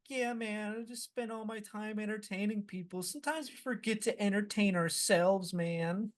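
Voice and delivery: high, soft put-on artist voice; narcissistic artist. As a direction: do a self-absorbed artist in a high, soft mock voice. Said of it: "like, a fucking narcissistic artist would say".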